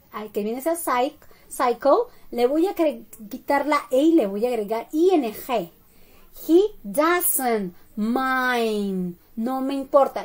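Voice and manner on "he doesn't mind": extremely slow, long-held words; teacher dictating at a crawl; slow model sentence. Shown as a demonstration. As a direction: stretch each word right out for learners.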